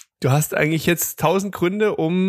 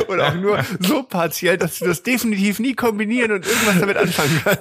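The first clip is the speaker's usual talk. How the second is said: laughing; 6 semitones higher than usual. Real, not acted. Joking banter, laugh heard.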